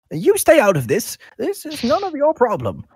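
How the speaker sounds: put-on high, loud old man's voice; scolding old shopkeeper. Old gentleman wagging his finger at a stranger, high scolding. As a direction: tell off the meddler in a high voice. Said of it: "Gentleman turns and is wagging his finger".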